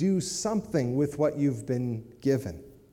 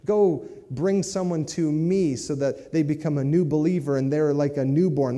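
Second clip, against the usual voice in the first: ordinary talk, nothing marked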